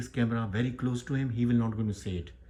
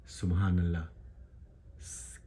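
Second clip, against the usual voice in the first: ordinary talk, nothing marked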